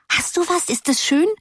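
high eager repeat, falling back on du; expectant cry. A narrator's reading. Schoolgirls crying out expectantly, high and pressing. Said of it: "schrien sie erwartungsvoll".

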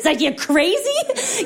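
high, slow disbelieving cry through a laugh; incredulous. Acted. Comic to the German audience about zipper merging, high and incredulous. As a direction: stretch it out in mock disbelief. Outraged at zipper-merge rule.

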